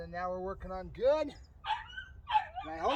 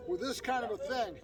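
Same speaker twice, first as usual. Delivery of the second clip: normal talking voice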